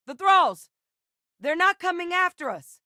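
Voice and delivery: high, loud alarmed cry; sudden alarm in combat, voice shooting up; alarmed shout. Acted. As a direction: yell the warning in a panic. Alarm at approaching enemies.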